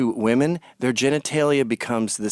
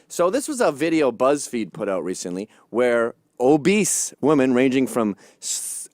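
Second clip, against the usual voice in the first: normal talking voice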